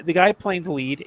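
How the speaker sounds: ordinary talk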